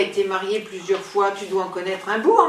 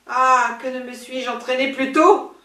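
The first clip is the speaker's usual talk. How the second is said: ordinary delivery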